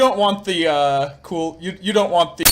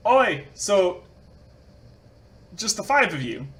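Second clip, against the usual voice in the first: put-on rough Australian accent, slightly raised; 4 semitones higher than usual. [name] greets the five students in an Australian accent. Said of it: "really horrible with accents".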